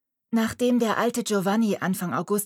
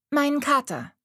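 put-on higher girl's voice; young girl; 8 semitones higher, 4 dB louder than usual. A narrator's reading. Girl tells Mariella she is looking for her cat. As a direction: answer as the prim girl.

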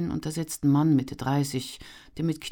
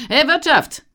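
much higher, loud bellow for service; loud call; 13 dB louder than usual. A narrator's reading. Traveller bellowing for the innkeeper, loud call. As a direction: demand the innkeeper's attention. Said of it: "Auf diesen Ruf hin".

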